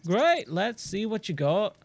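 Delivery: hammed-up mongrel accent for a friendly traveling trader; Giro in muddled accent. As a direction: blend Australian, Irish and Scottish accents, playfully. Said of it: "I have no idea what accent that is".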